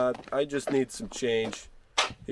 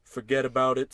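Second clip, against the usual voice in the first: ordinary talk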